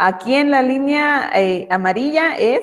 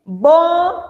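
read-out word; 6 semitones higher, 4 dB louder than usual. Shown as a demonstration. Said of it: "Abajo dice".

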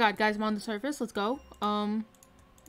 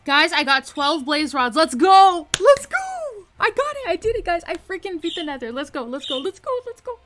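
screaming with joy, much higher and louder; excited shouting; 7 semitones higher, 9 dB louder than usual. Real, not acted. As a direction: yell it out in real triumph. Victory after beating the nether.

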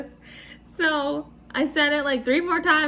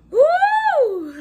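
woo; 12 semitones higher, 6 dB louder than usual. Real, not acted. Exhales after laughing fit.

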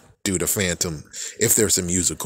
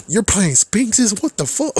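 mock disbelief; 13 semitones higher, 4 dB louder than usual. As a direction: squeak out shocked at an opponent's deck. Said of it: "And they were like".

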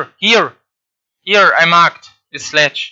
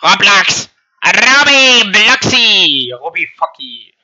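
goofy mocking voice; 4 semitones higher, 5 dB louder, slower than usual. Mocking the game's name.

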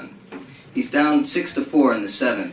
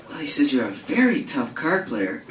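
sultry woman; 2 semitones higher than usual. Acted. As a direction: purr it like a seductive woman. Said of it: "voice of a woman".

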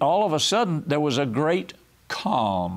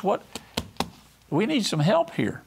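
ordinary delivery

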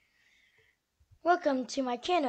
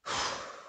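weary sigh; 5 dB quieter than usual. Acted. Weary reaction to squirrel.

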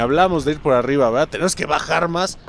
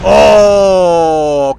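surprised shout; 3 semitones higher, 11 dB louder than usual. Real, not acted. Sudden surprise in game.

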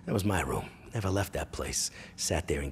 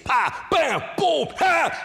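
very high, loud punching sounds; shouted impact noises; 9 dB louder, much slower than usual. Retold or imitated. Imitating mother hitting with spoon and shoe, shouted whacks. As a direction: yell out each blow explosively.

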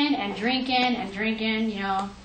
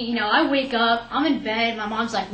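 ordinary talk

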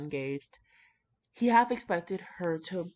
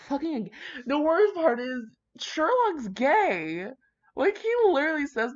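louder, higher worked-up rant; excited rant; 10 semitones higher, 6 dB louder than usual. Real, not acted. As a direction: vent it, all fired up. Ranting about Sherlock.

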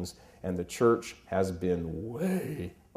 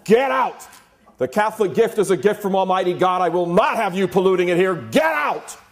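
bishop's high, loud furious yell; furious shout; 11 semitones higher, 10 dB louder than usual. Retold or imitated. Bishop Sheen throwing the hippie out, furious and shouting. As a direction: roar him out of the sacristy. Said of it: "Turns around, he goes".

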